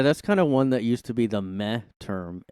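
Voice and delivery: ordinary delivery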